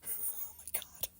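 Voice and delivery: breathless whisper of embarrassment. Real, not acted. Vlogger whispering, mortified at her outburst.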